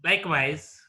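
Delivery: usual speaking voice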